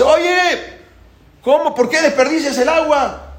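high, yelled indignation; outraged confrontation. Acted. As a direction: call out in acted outrage. Egipcio increpa al que riega. (reclamo indignado a gritos, agudo)

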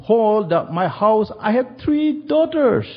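a touch of tearful pleading; crying pastor. Retold or imitated. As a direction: beg through tears for the family. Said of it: "He was crying me".

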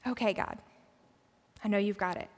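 sigh, then give in softly; quiet, low, yielding reply after a long sigh; resigned surrender. Acted. Believer giving in to God, quiet resignation.

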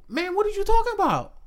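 high, fast denial in a different voice; denying boyfriend. Retold or imitated. Boyfriend denies touching the daughter, high and fast. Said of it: "And he was like".

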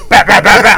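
quick popped "bap" sounds; imitated shoulder taps. Storyteller imitating the taps on his shoulder, punchy mimicked taps.

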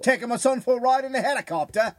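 high, loud Australian attempt slipping toward English; hammed-up, muddled Australian voice; bad Australian accent. Showing his Australian guy is spot on, muddled Australian accent. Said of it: "my Australian guy's spot on".

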